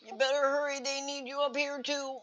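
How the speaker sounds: high, loud call to hurry; urgent calling out. Acted. Player to allies needed up here, calling out urgently. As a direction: shout for backup across the fight. Player's character calls to ally in fight.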